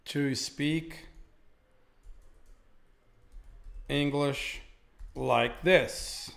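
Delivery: long pauses between chunks, as for copying down; slow dictation. Shown as a demonstration. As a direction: dictate it in pieces so learners can write. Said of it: "teacher dictates model sentence slowly".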